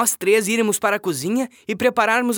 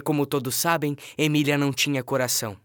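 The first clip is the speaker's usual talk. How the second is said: normal talking voice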